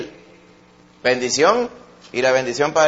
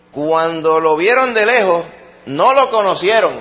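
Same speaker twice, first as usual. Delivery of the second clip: raised, ringing preacher voice; forceful preaching; 4 semitones higher, 4 dB louder than usual. Acted. Pastor challenging the congregation, preaching at full force. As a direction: drive the challenge home to the congregation.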